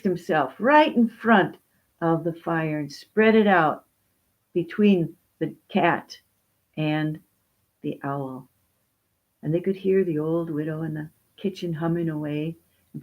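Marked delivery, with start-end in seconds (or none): none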